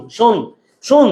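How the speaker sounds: শুন said alone as a clipped first syllable shon; syllable demo. Shown as a demonstration. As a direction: repeat শুন as its own beat. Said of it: "এটাকে আমরা তো সন্ধ্যা বলি না, বলি শুন".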